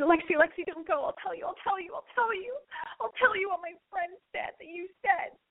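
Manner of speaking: high, whiny mock begging; hammed-up, near-tearful pleading in a high voice; desperate pleading. Retold man begging her to stay.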